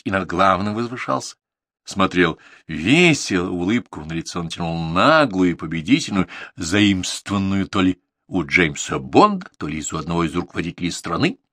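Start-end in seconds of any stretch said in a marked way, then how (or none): none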